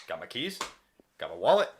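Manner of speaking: usual speaking voice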